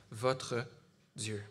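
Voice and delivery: ordinary talk, nothing marked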